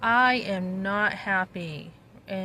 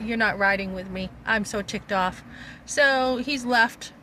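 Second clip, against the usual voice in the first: ordinary talk, nothing marked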